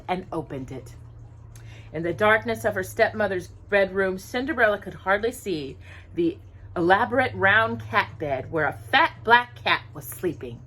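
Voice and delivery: ordinary delivery, nothing marked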